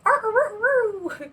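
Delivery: slow high mock arr calls; husband's mating call. Wife imitating her husband's phone call. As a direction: drag out each arr like a crowing bird. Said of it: "he will say".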